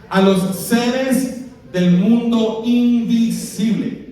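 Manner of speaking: drawn out slowly, word by word; stretched slow emphasis; slow emphatic phrase. Acted. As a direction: lean on each word for the congregation. Preacher stretching key phrase.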